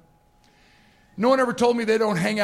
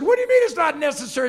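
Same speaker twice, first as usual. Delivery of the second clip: high, fast, loud objection; loud protest; 7 semitones higher, 5 dB louder, faster than usual. Retold or imitated. Passenger insisting soldier take his seat, indignant protest.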